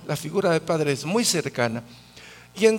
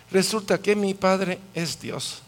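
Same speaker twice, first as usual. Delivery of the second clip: ordinary talk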